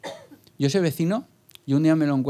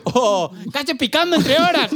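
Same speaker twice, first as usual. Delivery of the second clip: high loud goofy clown voice; 9 semitones higher, 4 dB louder than usual. Pretend. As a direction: play a scolding clown.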